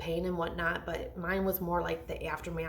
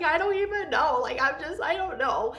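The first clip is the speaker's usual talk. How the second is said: high, tearful helpless reply; retold crying; 10 semitones higher, 4 dB louder than usual. Mom answers her husband while crying, high and helpless. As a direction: let the voice climb as if crying. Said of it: "I'm like".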